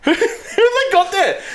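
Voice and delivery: giggling mock outrage; laughing. Real, not acted. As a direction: laugh it out in disbelief. Laughing disbelief at marketing.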